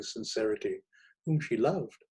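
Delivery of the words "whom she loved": usual speaking voice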